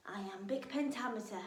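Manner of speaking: usual speaking voice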